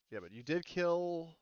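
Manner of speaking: usual speaking voice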